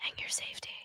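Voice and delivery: breathy dramatic whisper. Pretend. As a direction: drop to a whisper for effect. Mock-dramatic reading of fundraiser text.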